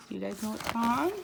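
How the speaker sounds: ordinary delivery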